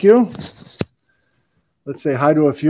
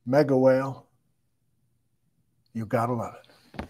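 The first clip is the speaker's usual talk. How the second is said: soft, offhand mega whale, much softer; quiet aside; 8 dB quieter than usual. Real, not acted. Host dropping the act, softly naming the cake. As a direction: mutter it to yourself after the show bit.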